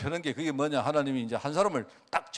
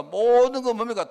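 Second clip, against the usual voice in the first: louder, higher and slower, driving the question home; emphatic preaching shout; 6 semitones higher, 7 dB louder than usual. Real, not acted. Preacher pressing his flock with a loud, slow question. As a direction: hammer the question at the congregation.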